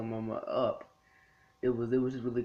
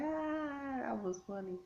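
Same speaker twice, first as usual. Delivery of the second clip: much higher, softer gasp after laughing; mock laughing sigh; 13 semitones higher, 8 dB quieter, slower than usual. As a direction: squeak it out like you're still recovering from laughing. Retells laughing on his bed.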